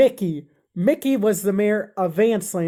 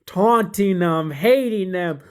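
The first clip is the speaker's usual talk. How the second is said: ordinary delivery, nothing marked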